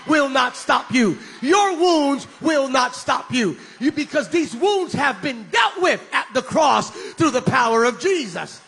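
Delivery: loud, slow preaching; preacher driving it home to the church; full-force preaching. Acted. Preacher to congregation, loud preaching.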